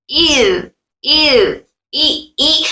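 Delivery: इर and ई each started with a sharp glottal catch; cut-off hamza. Shown as a demonstration. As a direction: clip the sound before इर, separate. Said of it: "आवाज को काट के separate कर रहे हैं".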